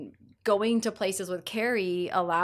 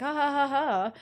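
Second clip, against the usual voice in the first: higher-pitched, spoken-out ha-ha-ha; mock laughter; 6 semitones higher than usual. Woman on processing a hurtful remark, mock laughing. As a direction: put on a laugh to brush it off. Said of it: "you laugh about these things".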